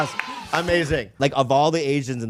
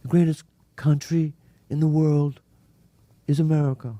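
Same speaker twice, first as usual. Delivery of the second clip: bad attempt at a Trump voice; lightly voiced Trump; Trump impression. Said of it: "That's terrible".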